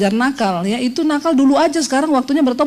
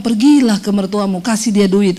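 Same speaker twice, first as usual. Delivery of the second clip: gentle counsel; 6 semitones lower than usual. Retold or imitated. Voicing the Holy Spirit.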